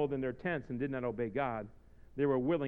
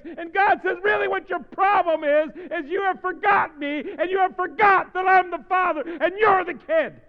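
sustained high shouted preaching; full-force preaching; 15 dB louder than usual. Real, not acted. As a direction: thunder it out to the whole congregation.

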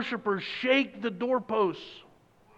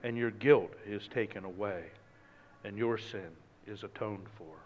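tender angelic pardon; gentle reassurance; 9 semitones lower, 8 dB quieter than usual. Retold or imitated. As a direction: comfort a guilty man. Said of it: "and he said".

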